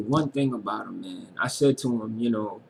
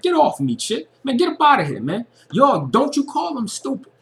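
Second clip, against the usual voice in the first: high, loud hammed-up angry Forrest; snapping; 11 semitones higher, 5 dB louder than usual. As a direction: snap at her, telling her off in a high mock yell. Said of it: "And he was snapping".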